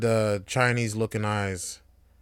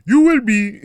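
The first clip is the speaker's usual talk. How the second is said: loud, high Nigerian parent; Nigerian accent; 11 semitones higher, 12 dB louder than usual. Retold or imitated. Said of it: "the accent would have been off".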